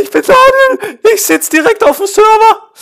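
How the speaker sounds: high crying scream; anguished scream. Real, not acted. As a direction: wail out in frustration. Gamer screaming in frustration.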